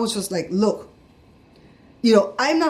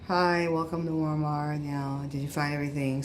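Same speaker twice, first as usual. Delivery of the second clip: weary cashier imitation; 6 semitones lower, 9 dB quieter than usual. Cashier greets a customer at Walmart on a bad day, low and listless. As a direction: mimic a worn-down greeter, dragging it out quietly. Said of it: "she was, like, having a bad day".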